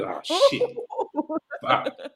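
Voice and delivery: high-pitched mock dismay; squeaky 'caught out' reaction; retold dismay. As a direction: let the pitch jump up in comic alarm. His thought when caught laughing.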